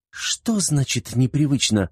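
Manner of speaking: high, drawn-out indignant challenge; pushy girl demanding an explanation; confronting. A narrator's reading. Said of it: "наехала на него Верпела".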